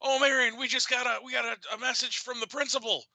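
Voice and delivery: high, loud alarmed cry; panicked yell. Retold or imitated. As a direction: fake panic to fool daughter. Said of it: "yelled in a sleepy voice".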